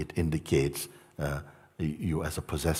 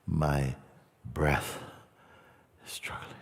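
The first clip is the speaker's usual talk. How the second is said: fading breath; 2 semitones lower, 3 dB quieter than usual. Shown as a demonstration. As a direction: let each phrase sink away, ending barely voiced. Slows to show struggling breath.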